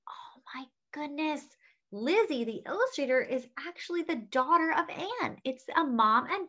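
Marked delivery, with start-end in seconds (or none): 0.1-1.4 soft breathy aside; whispered surprise. Real, not acted. Host marvels to the kids, whispering. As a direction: hush it like a little secret.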